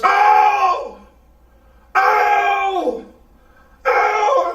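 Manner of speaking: loud high cries of pain; repeated high yelps; crazy yelling. Retold or imitated. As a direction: holler like a man gone crazy. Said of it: "out there yelling like he's crazy".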